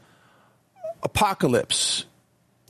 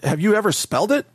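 usual speaking voice

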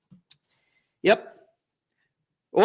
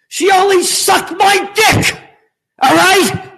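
high, indignant mock confession; hammed-up protest from a cornered politician; Slick Willie; 8 semitones higher, 3 dB louder than usual. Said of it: "how about Slick Willie?".

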